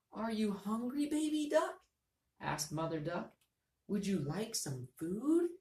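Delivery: high, anxious mother voice; worried mother duck. Shown as a demonstration. Teacher voicing Mother Duck worried about Baby Duck. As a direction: fret over the duckling. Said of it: "change our voice to match how they feel".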